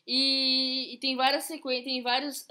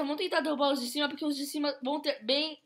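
ordinary talk

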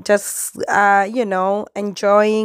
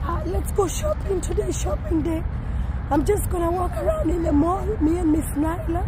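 strained voice; 6 semitones higher, 4 dB quieter, faster than usual. Real, not acted. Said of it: "I'm struggling to talk".

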